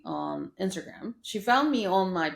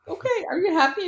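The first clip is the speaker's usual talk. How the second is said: much higher, louder excited asking; excited question; 11 semitones higher, 6 dB louder, faster than usual. Retold or imitated. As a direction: ask her brightly, pleased. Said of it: "I was like".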